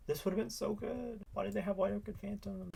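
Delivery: high, soft complaining; petulant gamer grumble; whiny. Real, not acted. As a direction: whine about the bad luck. Whining about opponent's cards.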